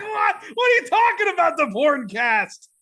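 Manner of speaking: high, loud disbelieving yell; incredulous shout. Retold or imitated. Host reacting to cohost's intro flub, shouting in shock. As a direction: blurt it out in total disbelief.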